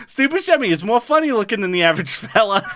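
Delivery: put-on light Minnesota accent summary read. Podcaster reading the Fargo recap in a light Minnesota accent. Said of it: "as I'm reading in this accent".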